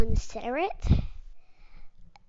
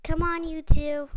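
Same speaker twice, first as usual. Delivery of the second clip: ordinary delivery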